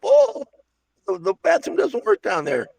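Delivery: a customer whining that the toilet is broken; mock customer complaint.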